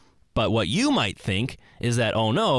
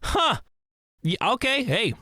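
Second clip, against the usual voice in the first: impressed concession; 12 semitones higher than usual. Retold or imitated. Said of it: "And they go".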